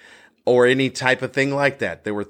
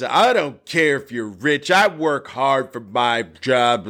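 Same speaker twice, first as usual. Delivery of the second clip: mock indignant heckler, mildly raised; retold yelling; 2 semitones higher, slower than usual. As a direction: imitate an aggrieved drunk without shouting. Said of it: "he's yelling".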